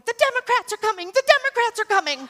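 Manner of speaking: high, loud panicky mock cry; high, loud mocking alarm cry. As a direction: ham up a frantic alarmist.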